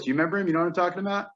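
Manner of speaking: usual speaking voice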